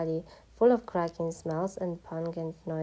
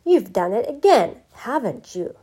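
loud, higher-pitched angry accusation; angry roar; 4 semitones higher, 10 dB louder than usual. A narrator's reading. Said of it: "he roared".

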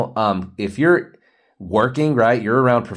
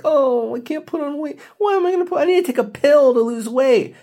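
much higher, whiny mockery of a dieter; mocking whiny dieter. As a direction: squeak it like a complaining dieter. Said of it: "and you're like".

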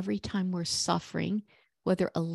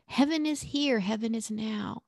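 normal talking voice

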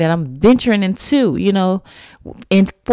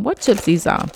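usual speaking voice

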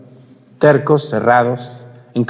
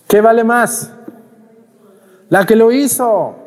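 emphatic preaching; 10 semitones higher, 3 dB louder, slower than usual. Real, not acted. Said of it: "preaching emphasis".

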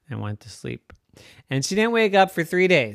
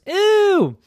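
high, loud squeal of disgust; recoil, grossed out; 7 dB louder than usual. Acted. Narrator reacts to nose picking.

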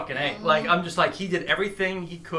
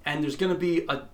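usual speaking voice

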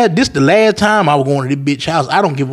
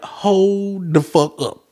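slow disbelief; 4 semitones higher, 4 dB quieter, much slower than usual. Retold or imitated. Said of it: "I was like".

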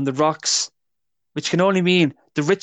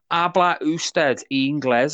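slow, careful tourist Spanish; slow clumsy Spanish accent; attempted Spanish; 3 semitones higher, much slower than usual. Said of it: "my attempt at being a Spanish person".

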